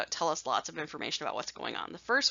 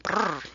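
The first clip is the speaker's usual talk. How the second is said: short silly brrr; lip-trill noise. Real, not acted. Teacher shrugging off her own sarcasm, silly brrr. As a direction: shake it off with a brief brrr.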